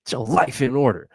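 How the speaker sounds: self-coaching mock rant; mock pep talk. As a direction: bark it at yourself. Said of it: "I'm like".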